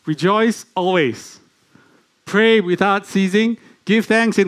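slow, rising scripture recital; preaching emphasis. Acted. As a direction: declare each command slowly.

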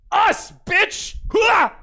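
high, loud battle yell; defiant shout. Acted. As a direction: shout back at the dragon. Dwarf answers the dragon.

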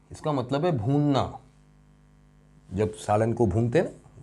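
usual speaking voice

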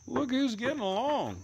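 singsong and high, dropping at the end; high-pitched pet talk. Real, not acted. Owner remarking on the pets getting along, high pet talk. Teasing the pets as they meet.

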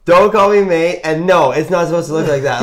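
injured snowboarder telling off the patroller, with attitude; serious attitude. Retold or imitated. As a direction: snap back at the patroller, fed up. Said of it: "hard to not have some serious attitude".

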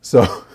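bright burst of real laughter; laughing. Real, not acted. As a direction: laugh at yourself mid-story. Laughs at own story.

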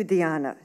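"Diana" pronounced dee-AH-nah; Spanish pronunciation. A narrator's reading. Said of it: "use Spanish pronunciation".

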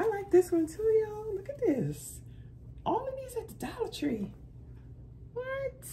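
high, thrilled show-off; bubbly delight at a bargain; excited. Real, not acted. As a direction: gush over the find.

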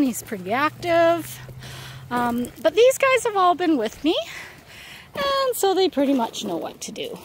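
ordinary talk

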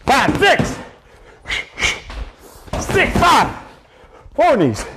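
high, effortful workout call-out; calling out counts. Real, not acted. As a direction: push the count out mid-exertion. Coach calling counts mid-exercise.

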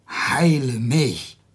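very slow, firm heile mich at normal volume; slow insistent plea. Acted. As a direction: lean on each word slowly. Hiob insists after "I am Satan.

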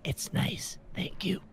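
soft breathy whisper; hushed, shy whisper of thanks; whispered line. Acted. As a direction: whisper it timidly from under the blankets. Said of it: "As it says".

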